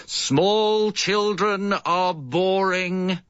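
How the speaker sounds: slow, high public announcement; grand proclamation. Acted. Father proclaiming to the world, slow and high. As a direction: declare it from the top of the climbing frame. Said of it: "and proclaim to the world".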